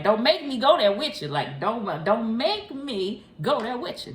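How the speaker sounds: sassy warning. Real, not acted. Playful warning to the Leo listener.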